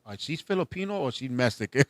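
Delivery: soft puzzled murmur; muttered gossip. Retold or imitated. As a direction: wonder under your breath. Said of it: "they look at her like".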